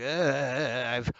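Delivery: lightly voiced lipless mummy groan. Artist imitating the lipless mummy, a hint of groan. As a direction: mumble like an old mummy. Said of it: "like an old, like".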